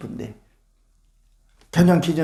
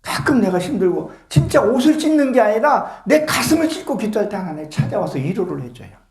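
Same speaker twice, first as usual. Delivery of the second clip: usual speaking voice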